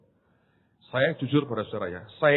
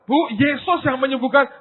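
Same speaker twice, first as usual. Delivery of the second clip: firm correction; 12 semitones higher, 7 dB louder than usual. Retold or imitated. Correcting a congregant, retold.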